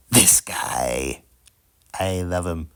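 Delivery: whispered and low; hushed, secretive reveal. Acted. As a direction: drop to a sly whisper for the punchline. Punchline about his own dad mug.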